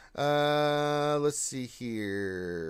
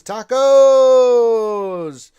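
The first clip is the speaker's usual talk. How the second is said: high, long holler; whooping game-show style holler; drawn-out shout; 10 semitones higher, 18 dB louder than usual. Acted. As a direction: belt it out high and stretch it. Reads chat greeting as shout.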